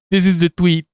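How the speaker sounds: usual speaking voice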